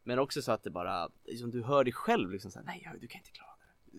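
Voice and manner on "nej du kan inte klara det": whispered discouragement; whispering inner voice. Acted. Voice telling the player they will fail, whispered. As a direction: whisper like a doubting voice in the head. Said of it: "du har röster i huvudet".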